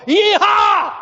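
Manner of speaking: loud, high cowboy whoop; Texas yeehaw. Retold or imitated. Texans whooping as they put money in the plate, loud high whoop. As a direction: holler it like a rodeo cowboy. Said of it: "they ought to go".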